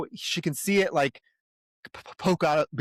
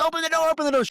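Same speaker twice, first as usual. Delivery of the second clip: high, strained yell of a man in agony; screaming in pain; 4 dB louder, much faster than usual. Retold or imitated. Villain with his hand crushed in the door, screaming high. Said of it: "he's screaming fucking blood".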